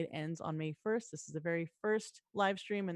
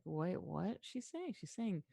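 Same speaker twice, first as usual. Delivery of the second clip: murmuring crowd; 2 semitones higher, 6 dB quieter than usual. Acted. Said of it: "They're like".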